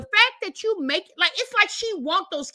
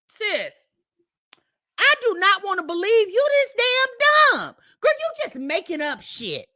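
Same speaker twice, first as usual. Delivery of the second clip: ordinary talk, nothing marked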